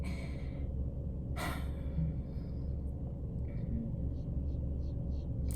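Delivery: a long, airy, drawn-out sigh; long heavy sigh. Real, not acted. Speaker admitting self-doubt sighs heavily. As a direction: let out a weary sigh before going on. Heavy pause while opening up.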